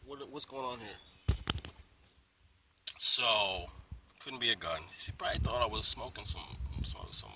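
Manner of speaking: normal talking voice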